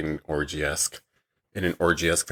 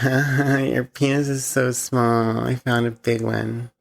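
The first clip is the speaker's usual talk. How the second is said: slow, higher, taunting voice; mocking woman voice; 5 semitones higher, slower than usual. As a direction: drawl it out like a sneering queen. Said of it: "She's like".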